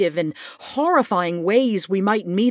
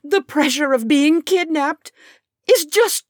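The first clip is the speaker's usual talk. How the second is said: rising from higher to much higher and louder, near tears; trembling apology; 12 semitones higher, 6 dB louder than usual. Acted. Morton apologizing to Taya, trembling and climbing higher. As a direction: build the shaky apology to a breaking pitch. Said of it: "my voice trembling".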